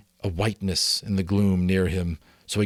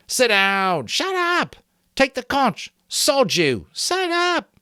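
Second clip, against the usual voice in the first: high, loud overlapping shouts; yelling crowd; 6 dB louder, slower than usual. A narrator's reading. Boys in uproar at the assembly, loud high yelling. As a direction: bellow them all down.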